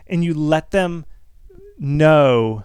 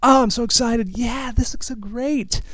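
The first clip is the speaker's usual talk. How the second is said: excited tone; 9 semitones higher than usual. Shown as a demonstration. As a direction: show how happy you are with the art. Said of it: "the tone of your voice matters".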